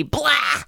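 high, shouted retching blah; disgusted blah. Real, not acted. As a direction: blurt out a gagging noise. Mock disgust while reading.

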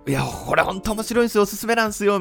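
high, loud, gushing promo voice; hyped-up sales pitch. Retold or imitated. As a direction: oversell it like a pushy salesman. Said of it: "みたいなことはほんとね、えー、言えなくて".

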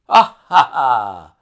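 loud, knowing "ah, ha, ha"; hearty acted laugh. A narrator's reading. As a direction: laugh it out in separate beats. Pinner laughs at the Monday start.

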